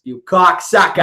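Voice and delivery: loud, drawn-out crude-comic insult; Andrew Dice Clay. Retold or imitated. Echoing a comic's catchphrase, loud slow impression. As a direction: stretch the insult out like a brash stand-up. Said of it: "talk like that".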